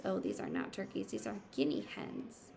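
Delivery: ordinary talk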